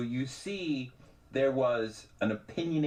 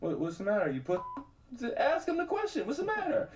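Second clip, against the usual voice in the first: high, teasing shoulder devil; little devil voice; 12 semitones higher than usual. Retold or imitated. Devil on his shoulder goading him, high teasing voice. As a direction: egg him on like a mischievous devil. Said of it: "the little devil on my shoulder".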